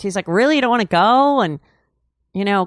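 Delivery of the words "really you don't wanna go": mock annoyed brother. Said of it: "he was pissed".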